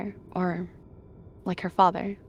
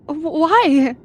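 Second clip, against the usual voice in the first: stammer, then burst out high and loud; rising, disbelieving cry; frightened stammer; 9 semitones higher, 8 dB louder than usual. A narrator's reading. Frightened cat stammers at attacker.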